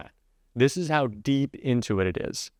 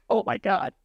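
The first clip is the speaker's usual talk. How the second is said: mock-disgusted recoil, pitched higher; repelled fabric; 8 semitones higher than usual. Commentator voicing suit fabric repelled by its wearer, mock revulsion. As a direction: lightly voice horrified cloth shrinking away. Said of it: "fabric is so repelled by his being".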